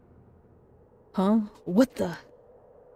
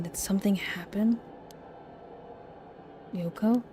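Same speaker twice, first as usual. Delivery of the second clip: hushed whisper; a nervous little whisper; 7 dB quieter than usual. Real, not acted. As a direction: whisper so nothing hears you. Hushed worry in tense scene.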